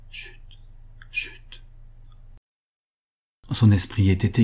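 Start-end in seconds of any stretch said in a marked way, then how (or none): 0.1-1.6 soft whispered shushing; hushed. A narrator's reading. Mother caressing her dead son as if asleep, whispering. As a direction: hush gently, barely audible. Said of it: "en disant tout bas".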